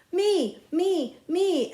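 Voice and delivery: put-on high, loud kids yelling. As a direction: shout to be picked. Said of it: "everybody yelled".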